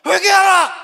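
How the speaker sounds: high, loud shouted call to repent; booming prophet's cry; thundering rebuke. Retold or imitated. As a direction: roar it like a desert prophet scolding the crowd. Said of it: "호통을 치면서".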